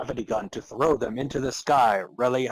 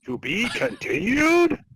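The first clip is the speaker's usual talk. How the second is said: much higher, slow teasing ending; dramatic cliffhanger; 11 semitones higher, 4 dB louder than usual. Acted. Narrator ends the story on a high, slow cliffhanger. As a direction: tease the next episode.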